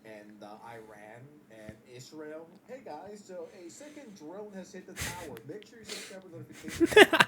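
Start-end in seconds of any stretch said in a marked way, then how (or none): 2.7-7.2 mock streamer news. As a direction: parody streamer voice, loudest on the last line. Mocking a streamer covering war.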